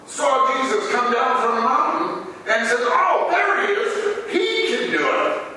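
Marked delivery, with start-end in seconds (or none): 2.8-5.4 high, eager voice pointing him out; a hint of an excited bystander, pitched up; excited onlooker. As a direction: exclaim it as an onlooker who believes. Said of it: "It's like that guy that".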